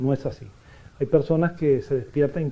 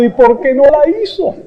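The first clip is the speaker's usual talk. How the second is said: 1 mock retort; 11 semitones higher, 11 dB louder than usual. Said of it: "y yo le digo".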